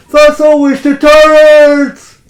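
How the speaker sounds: high, very loud defiant scream; screamed battle cry. A narrator's reading. Booth to the audience, knife flashing, defiant scream. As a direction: roar it out slowly, dragging out the last word. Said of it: "and he screams".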